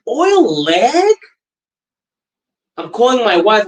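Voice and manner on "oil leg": confused question. Retold or imitated. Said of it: "So I'm like".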